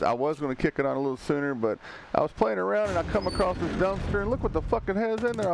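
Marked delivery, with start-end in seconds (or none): none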